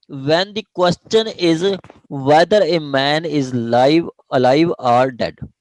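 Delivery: reading the law out slowly, word by word; slow dictation. Shown as a demonstration. Teacher reading the section slowly to students, dictation pace. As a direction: dictate each phrase slowly for the class to follow. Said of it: "teacher reads section slowly aloud".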